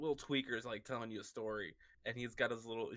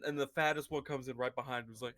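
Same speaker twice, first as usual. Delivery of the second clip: ordinary talk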